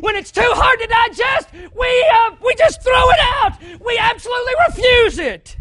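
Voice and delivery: loud, high, shouting sermon voice; full-force preaching. Acted. Preacher shouting to his church about rejecting truth, full-force preaching. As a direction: drive it home from the pulpit.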